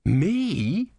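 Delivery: high, startled squeak. Acted. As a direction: blurt it out in shock. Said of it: "said Brother".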